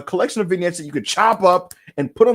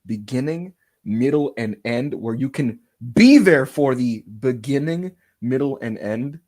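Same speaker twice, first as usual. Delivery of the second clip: low, slow, measured proclamation; mock-solemn plea; 6 semitones lower, slower than usual. Fan spokesman begging the wrestler to stay away, mock-solemn. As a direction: space out each phrase with ceremony.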